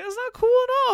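squeaky, very high protest; put-on high-pitched whine of denial; mock protest. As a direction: shoot up high in hammed-up objection. His retort to the cop.

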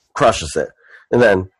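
ordinary delivery

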